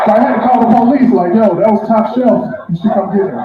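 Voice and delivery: high-pitched, indignant comic outburst; mock outrage. Driver griping about spilt top-shelf tequila, mock outrage. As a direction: raise the pitch sharply in hammed-up outrage.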